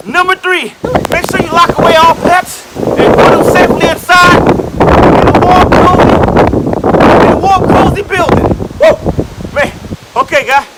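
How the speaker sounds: loud shouted tips; shouting over wind. Acted. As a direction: yell it over a storm.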